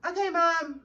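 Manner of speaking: mock obedient dog. Dog voiced as obedient child.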